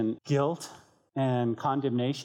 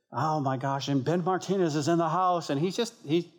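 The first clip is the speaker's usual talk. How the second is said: higher-pitched imitation of an excited friend; happy enthusiasm; 4 semitones higher than usual. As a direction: lightly gush like an overjoyed fan. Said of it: "happy, and he's just".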